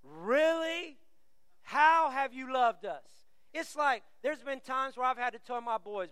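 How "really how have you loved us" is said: put-on teenager attitude. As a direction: question it slowly with teenage sarcasm. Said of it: "Pretend your teenager is saying it".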